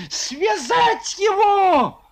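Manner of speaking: high, slow shrill shriek; thin squeal. Acted. Resnikov shrieks at Foma to seize him, high squeal. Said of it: "тонким голосом завизжал".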